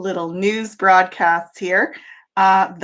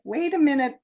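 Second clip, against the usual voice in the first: higher, hammed-up astonishment; disbelieving sleeper, higher; mock puzzled; 8 semitones higher than usual. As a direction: act baffled by someone's calm. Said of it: "they're like".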